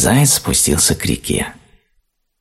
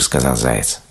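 ordinary delivery

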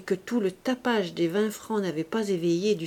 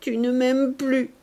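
tearful despair; 5 semitones higher, 4 dB louder, slower than usual. A narrator's reading. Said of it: "ses yeux s'emplirent de larmes".

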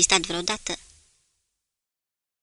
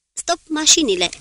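higher-pitched called-out order, moderately raised; comandă strigată; 8 semitones higher, 3 dB louder than usual. A narrator's reading. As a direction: call the command across the bridge. Ordin strigat la manevra de acostare. (comandă dată cu glas ridicat)

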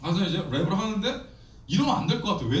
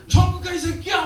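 higher, louder laughing yell; mock yell; 11 semitones higher, 5 dB louder than usual. At the juvenile detention kids, a laughing mock yell. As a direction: grab them and yell it. Grabs kids by collar, yells.

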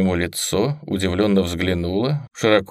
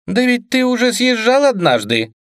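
high, mocking voice; mocking sister voice; 14 semitones higher, 4 dB louder than usual. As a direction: needle him in a high voice. Liv needling Gavin.

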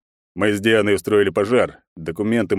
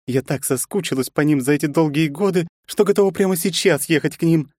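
cheerful Yufi; 9 semitones higher than usual. A narrator's reading. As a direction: gush happily about the family. Yufi's cheerful high voice. (весёлая Юфи, высоким голосом)

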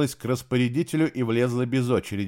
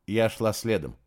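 usual speaking voice

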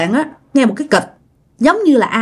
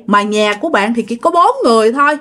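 ordinary delivery, nothing marked

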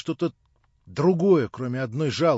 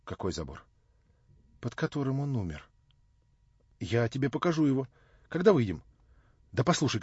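ordinary delivery, nothing marked

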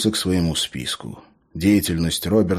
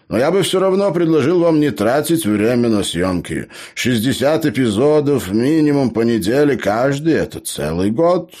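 animated, higher-pitched gnome voice; gnome, arguing; 7 semitones higher, 5 dB louder than usual. Acted. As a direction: argue it point by point, lively and raised. Gnome argues against the filming.

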